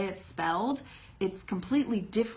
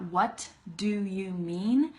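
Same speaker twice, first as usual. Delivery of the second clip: slow with stops between words; over-careful, every sound fully said; much slower than usual. Shown as a demonstration. Teacher showing it is too much effort, word by word. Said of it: "how much I have to stop in between the words".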